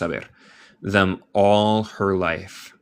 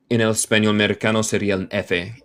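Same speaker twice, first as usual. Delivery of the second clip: ordinary talk, nothing marked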